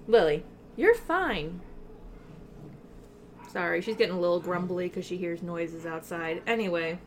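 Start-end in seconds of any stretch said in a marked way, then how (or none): 0.1-1.6 soothing the dog. Real, not acted. Calming the grumbly dog.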